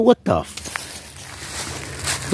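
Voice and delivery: breathless stunned whisper, drawn out slowly. Real, not acted. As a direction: whisper it in disbelief, trailing off. Stunned at found evidence.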